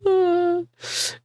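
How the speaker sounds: loud, high cackle; high burst of laughter. Real, not acted. Streamer cracking up at a viewer's boast, loud high laugh. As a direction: crack up hard at the joke.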